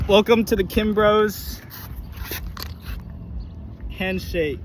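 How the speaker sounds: mock announcer. Brothers introducing their handshake to the camera, hammed-up announcer. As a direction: ham it up like a ring announcer.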